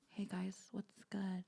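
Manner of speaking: slow, whispery mock model voice; soft, drawn-out imitation of online flirting; mock sultry greeting. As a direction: whisper it slowly like a posing influencer.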